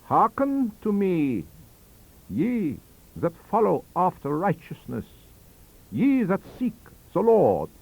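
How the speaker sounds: high, slow, intoning Scripture; dark scriptural quotation. A narrator's reading. Von Hohenheim flinging Scripture at the shepherd, slow high darkly intoned. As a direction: intone it like a sinister preacher. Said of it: "smiled darkly at me and flung me a quotation".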